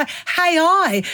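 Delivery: "A I" said with an added H, like "hay eye"; mispronounced AI. Acted. Cockney host garbles the tech term, "A I" said as "hay eye". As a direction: put a Cockney H on the front of "A I". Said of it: "don't you mean A I".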